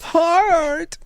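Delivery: high cartoon hero call of Heart; squeaky mock power call; goofy Heart call. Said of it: "that's from the".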